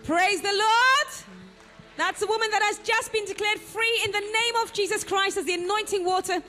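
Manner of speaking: ordinary delivery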